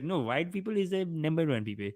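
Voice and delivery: a hint of a put-on Indian accent; mocking imitation with a touch of accent; mock inner voice. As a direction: lightly parody his inner colonial voice. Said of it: "some voice in his head that's like".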